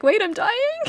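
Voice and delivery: high, loud startled squeak; shocked question. Acted. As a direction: jump way up in disbelief. Character shocked at own diagnosis.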